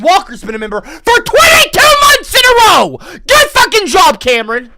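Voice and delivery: loud, high hype-man shout; excited shout. Real, not acted. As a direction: yell it like a stream alert. Hyping a member milestone.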